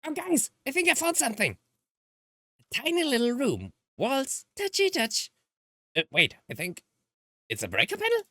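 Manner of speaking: cute character groping in dark; high cheerful character. Acted. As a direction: play the bright curious girl.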